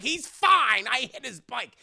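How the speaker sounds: high, loud self-defence; loud indignant protest. Retold or imitated. Truck driver accused by a reporter, protesting loudly. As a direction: burst out in protest.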